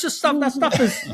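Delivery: high, laughing disbelief. Real, not acted. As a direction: laugh while you say it. Laughing at the story.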